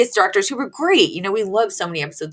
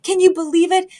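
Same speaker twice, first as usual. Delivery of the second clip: high, excited giddy gush; imitating giddy Fran; 10 semitones higher than usual. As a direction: gush it all out, over the moon. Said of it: "She's like".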